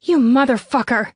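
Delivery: unrestrained angry curse; spitting fury. A narrator's reading. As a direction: spit it out in a rage. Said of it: "not even thinking about keeping a hushed tone when I spit my fury".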